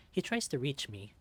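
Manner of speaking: usual speaking voice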